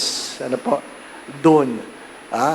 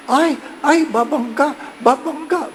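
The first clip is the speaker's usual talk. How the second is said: higher alarmed yelps as the bus closes in; panicked cry; 11 semitones higher than usual. Retold or imitated. Priest sees bus coming at his car, alarmed cry. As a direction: cry out as the crash comes. Bus about to hit him.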